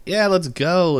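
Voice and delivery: mock rallying cheer; excited cheer. Everyone keen for the concert, excited. Voicing the eager group's reply.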